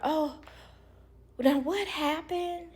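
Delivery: high, slow fake-concerned voice; mock concern. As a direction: drag it out with fake worry. Parents' fake concern mocked.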